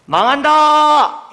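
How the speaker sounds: a drawn-out, booming shout of doom; loud proclamation. Shown as a demonstration. Preacher booming a prophet's warning to the congregation. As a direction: cry it out like a prophet warning the people. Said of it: "제가 한번 큰 소리로 해볼게요".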